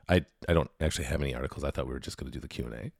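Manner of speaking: fast, low and quiet, pretending to confess; mock sheepish admission. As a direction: rattle it off low like a caught slacker. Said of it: "I would go".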